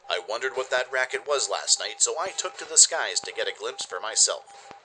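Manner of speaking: brisk radio news bulletin delivery; radio news read. Acted. Radio DJ reports the Gekzilla story to listeners, newsy.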